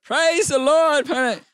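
high, long-held shout of praise; shouted praise, stretched and high-pitched. Retold or imitated. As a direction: reenact belting praise out loud, drawn out and far above normal pitch. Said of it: "He wants us louder".

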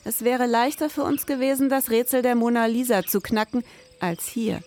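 low, measured crime-novel voiceover; brooding storyteller reading; noir story narration. A narrator's reading. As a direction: set the scene like a detective story.

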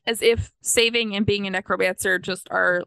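usual speaking voice

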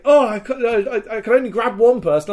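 high, loud, stammering excuse; re-enacted flustered protest. Rescuer excusing himself to the player, high flustered mock protest.

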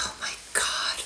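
hushed, whispered mock horror; dread-filled whisper. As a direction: whisper it as if overwhelmed. Retells dread at accent demand.

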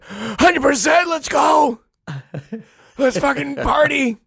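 high strained cracking voice; cracking sick voice. Retold or imitated. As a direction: croak it out through a wrecked throat. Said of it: "cartoonish how bad my voice was".

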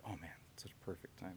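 breathy whisper. Retold or imitated. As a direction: whisper it to yourself. Quoting own thought softly.

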